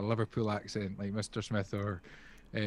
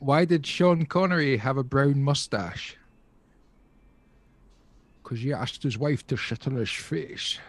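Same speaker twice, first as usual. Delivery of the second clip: Sean Connery impression; 2 semitones higher, 5 dB louder than usual. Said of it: "a joke in the style of Sean Connery".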